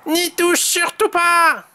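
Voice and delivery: high, slow, emphatic warning; warning Tom. A narrator's reading. Tom warns his friend off the object, high urgent warning. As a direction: warn high, stretching the words. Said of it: "L'avertit Tom".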